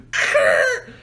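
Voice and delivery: sudden very high squawk; imitated screech. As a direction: let out a short high screech. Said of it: "The motherfucker goes".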